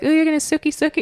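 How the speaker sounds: high mock schoolkid taunt; middle-school teasing. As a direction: tease like a kid in the schoolyard. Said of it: "when you're in middle school".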